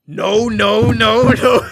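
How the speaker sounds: loud, high hammed-up refusal; imitated outraged shouting; mock angry yelling. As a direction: imitate an old man's tantrum at full volume. Imitating angry old customer's outburst.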